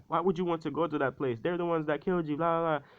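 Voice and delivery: high-pitched mock angry protest; mad, aggressive mother. Mother objects to son returning to that place, mock-mad protest. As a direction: nag him in a high, agitated run. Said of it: "she's starting to get mad, aggressive".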